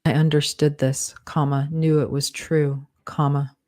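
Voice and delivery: steady dictation with spoken punctuation; dictating. Acted. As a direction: read it out like dictating a draft. Author dictates novel with punctuation.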